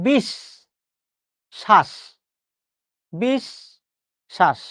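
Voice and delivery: "বিশ" and "শ্বাস" as bish... shash, long gaps; syllable-split pronunciation. Shown as a demonstration. Teacher giving the quiz answer, "বিশ শ্বাস" syllable by syllable. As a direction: separate "বিশ" from "শ্বাস", each syllable isolated. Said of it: "খেয়াল করে দেখেন তো".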